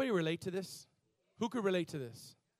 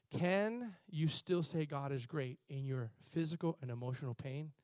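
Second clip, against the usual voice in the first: ordinary talk, nothing marked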